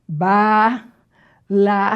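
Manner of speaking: "balaustre" split ba, la, us-tre with long pauses; syllable by syllable. Shown as a demonstration. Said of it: "a sílaba tônica é o U".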